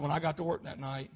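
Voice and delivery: low, soft admission; subdued confession. Acted. Watchman admitting the truth in court, subdued confession. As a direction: confess it quietly.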